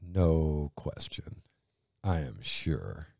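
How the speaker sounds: slow, unvoiced whisper of quiet conviction; a soft, slow whispered vow of certainty; hushed certainty. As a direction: breathe it out slowly, utterly sure. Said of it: "And she goes".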